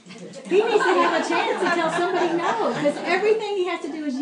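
high, loud words breaking into laughter; laughing. Retold or imitated. Quoting the therapist to the audience, laughing.

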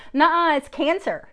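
slowly and loudly correct the mother; emphatic correction. Retold or imitated. Doctor correcting a mother on the phone, emphatic.

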